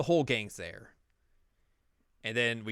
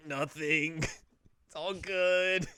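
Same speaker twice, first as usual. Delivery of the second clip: strained cheerfulness; 6 semitones higher, much slower than usual. Acted. Beaten Yu hides his pain.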